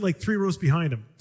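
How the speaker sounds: ordinary delivery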